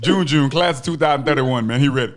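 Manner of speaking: mock announcer. Co-host announces new player.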